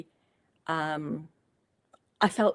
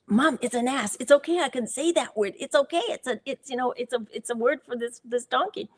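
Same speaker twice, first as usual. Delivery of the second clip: put-on raised-pitch child's voice, bubbling with excitement; excited little boy; 6 semitones higher than usual. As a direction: play the thrilled son, higher than your own voice. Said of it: "So it was like".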